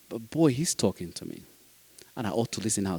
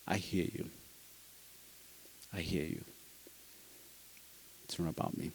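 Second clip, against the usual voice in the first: near whisper, much softer, calming; soft reassurance; 7 semitones lower, 21 dB quieter than usual. Retold or imitated. A foster dad calming an angry mother, hushed and soft. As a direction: say it gently so the anger can settle.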